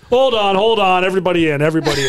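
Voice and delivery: loud, high coach's call to the team. Retold or imitated. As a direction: holler everybody over like a coach.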